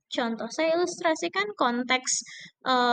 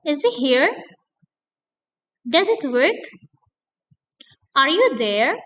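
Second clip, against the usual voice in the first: loud, rising drill questions; teacher modeling questions loudly; 6 dB louder than usual. Shown as a demonstration. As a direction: call out each question for the class to repeat. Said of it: "repeat after me".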